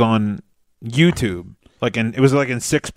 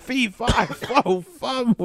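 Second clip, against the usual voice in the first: lightly put-on high, soft chant; a hint of a high, playful giant chant; lawyer quote; 12 semitones higher, 5 dB quieter, much slower than usual. Retelling the lawyer's joke to a friend, lightly put-on high voice. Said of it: "the lawyer walks in, he's like".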